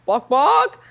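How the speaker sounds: loud, high chicken squawk; hen clucking. A narrator's reading. Louis teases his friend by turning hen, loud clucking. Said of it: "shape-shifting into a hen".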